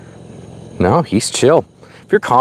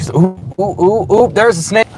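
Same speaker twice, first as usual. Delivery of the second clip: excited; 13 semitones higher, 3 dB louder than usual. Real, not acted. Spots a snake on the road.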